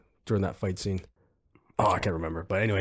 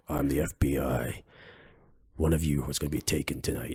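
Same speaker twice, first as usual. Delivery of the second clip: gruff voice; 7 semitones lower, 5 dB quieter than usual. Retold or imitated. FBI agent announcing a kidnapping to the players, gruff voice. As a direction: imitate an official talking low and gruff. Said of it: "he's got that gruff voice".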